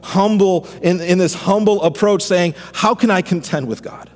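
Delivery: fervent pastor preaching Job's cry; preaching at full force. Acted. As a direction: preach it out, rising to a climax. Builds sermon over music.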